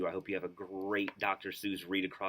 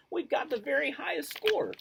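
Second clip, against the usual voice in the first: jubilant; 5 dB louder than usual. Acted. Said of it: "he jubilantly roared".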